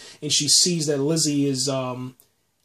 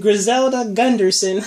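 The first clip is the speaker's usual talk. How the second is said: mock little girl; 6 semitones higher, 4 dB louder than usual. Repeating the doll's name.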